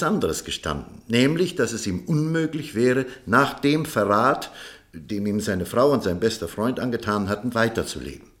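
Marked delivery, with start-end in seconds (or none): none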